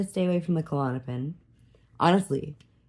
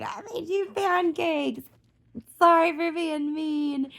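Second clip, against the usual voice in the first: high, slow sugary voice; sweet apology; 12 semitones higher, slower than usual. Retold or imitated. Mom apologizing to her daughter over breakfast, high sweet coo.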